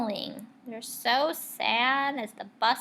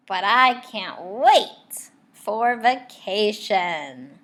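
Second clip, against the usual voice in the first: slow, louder glee; gleeful; 9 dB louder, much slower than usual. Acted. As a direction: savour the secret joy. Teacher's secret glee.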